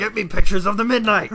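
loud barking editor demand; barking newspaper editor. Acted. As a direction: bark the order like a boss. Editor demands photos, joke.

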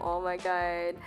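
normal talking voice

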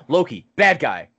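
loud, urgent mock command to a dog; calling the dog. Friend acting out alerting the dog to an intruder, calling out. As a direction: call out an alarm to your dog.

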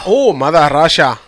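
loud mock Russian accent. Echoing his friend's attempt, loud Russian accent. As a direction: boom it out in a put-on Russian accent. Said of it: "Give me a Russian accent".

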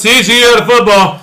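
shouted high and loud; loud catchphrase. Acted. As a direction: belt it out like a hype man. Show catchphrase call-out.

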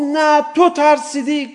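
slow, high, loud taunt; taunting accusation. Acted. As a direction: jeer at him, drawing it out. Son taunts his father.

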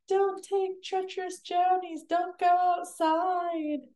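very high, put-on mock warning voice; TikTok impression. Showing the co-host the TikTok, high-pitched imitation. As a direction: draw out the last words, high and silly. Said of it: "It's a TikTok where it's like".

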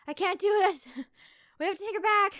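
loud, high hammed-up panic; mock despair. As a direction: wail in exaggerated overwhelm, laughing between lines. Retold sleep-deprived panic, hammed up.

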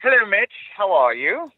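high, loud posh British greeting; posh British accent. Pretend. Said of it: "my really posh British accent".

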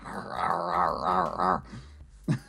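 soft low bear grumbles; contented bear growl. Pretend. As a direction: grumble like a chewing panda. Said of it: "I'm like".

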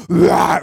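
loud mock lion growl; hammed-up roar for his son; playful growl. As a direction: growl like a dad playing tiger. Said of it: "growl at each other real close".

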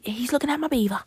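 quick, loud cartoon outburst; cartoon quote. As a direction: blurt it like an indignant cartoon character. Quotes Angry Beavers line.

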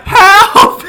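high, loud hammed-up scream for help; mock yell. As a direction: shriek help like a victim. Mock cry for help at clinic.

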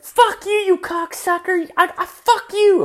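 retold furious yell. To the cashier who lipped off, a high furious retold yell. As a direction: scream it back at the drive-thru guy way up high. Retold angry clash at drive-thru.

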